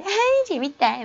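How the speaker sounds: high, slow, playful schoolgirl squeal; girly schoolgirl voice. Retold or imitated. Teacher mimics a schoolgirl walking happily, high playful voice. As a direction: play the carefree girl with flowing braids.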